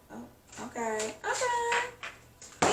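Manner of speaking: high drawn-out okay; surprised okay. Real, not acted. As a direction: react to a surprising card, high. Reacting to a pulled card.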